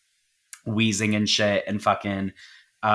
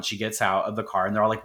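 ordinary talk, nothing marked

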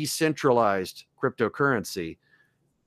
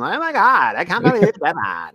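put-on pitched-up bitchy Valley girl; Valley girl Karen, pitched up and indignant; 5 semitones higher, 6 dB louder than usual. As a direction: play the snooty Karen, high and loud. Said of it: "I have a Karen character that I just pitch up and make her sound like a Valley girl".

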